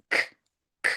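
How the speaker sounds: k as a bare puff of air; native-accent k. Shown as a demonstration. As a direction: say k without any uh. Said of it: "their own accent which they speak in".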